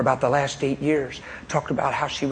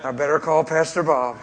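ordinary talk, nothing marked

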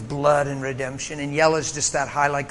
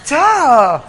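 mock sarcasm, higher, louder and very slow; sarcastic duh; 8 semitones higher, 8 dB louder, much slower than usual. Preacher mocking his own stubborn reply to God, drawn-out sarcasm. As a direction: drag out the sarcasm.

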